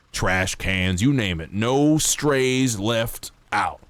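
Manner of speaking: put-on lightly drawled generic cowboy accent reading rules; a hint of an old west townsman. Streamer reading game rules to chat, light cowboy accent. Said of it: "I'm doing a cowboy accent".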